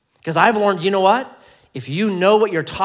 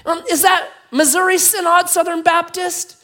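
high, loud mock interrogation; probing rescuer; 6 dB louder than usual. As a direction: call the question down with hammed-up fussiness. Rescuer calls narrower question.

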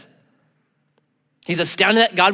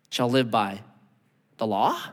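ordinary talk, nothing marked

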